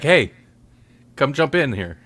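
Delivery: ordinary talk, nothing marked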